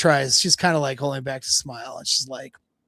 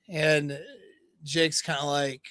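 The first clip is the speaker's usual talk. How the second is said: ordinary talk, nothing marked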